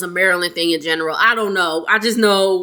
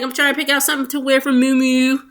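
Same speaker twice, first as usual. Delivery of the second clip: quick, lightly imitated Baltimore accent; 3 semitones higher, much faster than usual. Woman telling her bestie about her outfit, mock Baltimore accent. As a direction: put a hint of Baltimore on it, hurried. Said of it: "Baltimore accent".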